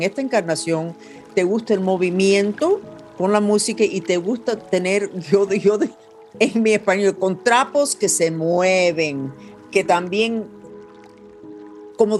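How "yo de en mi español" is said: ordinary delivery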